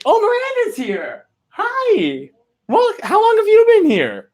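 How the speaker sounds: very high, squealed delighted greeting; excited surprise. Real, not acted. Streamer suddenly spots a friend in the chat, excited surprise. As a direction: shriek with surprise at a friend turning up.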